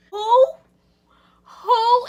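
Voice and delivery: loud, high yelp of disbelief; shocked shout. Retold or imitated. Re-enacting her reply to boyfriend, shouted high disbelief.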